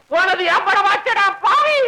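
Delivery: high wail rising into a curse; anguished scolding. Acted. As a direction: scold in comic despair, pitch climbing. Father curses son over lost money.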